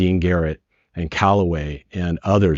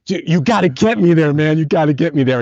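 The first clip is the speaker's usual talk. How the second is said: higher, louder frantic begging; urgent plea; 9 semitones higher, 4 dB louder than usual. Retold or imitated. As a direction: plead with the driver like time is running out. Begging the bus driver urgently.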